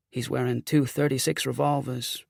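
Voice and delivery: plain reading voice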